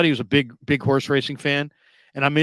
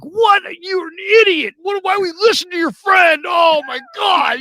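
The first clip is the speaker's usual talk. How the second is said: hammed-up high-pitched furious shouting; losing it; 6 dB louder than usual. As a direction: imitate a brother screaming in outraged disbelief. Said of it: "My brother is losing his S".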